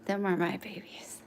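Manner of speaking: whispered, breathy repeat; soft whisper to the puppies. Real, not acted.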